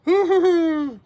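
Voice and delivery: loud, high hooting laugh; high-pitched hoo-hoo laugh. Acted. Teacher reacting to the spider hunt, hooting laugh.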